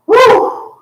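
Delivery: high whooping cheer; excited whoop. Real, not acted. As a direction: let out a big whoop. Coach cheers end of round.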